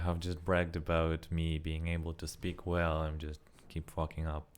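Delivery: ordinary talk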